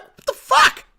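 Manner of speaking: high, loud mock outrage; shocked disbelief. Retold shocked reaction to price.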